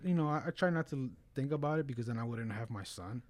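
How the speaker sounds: ordinary talk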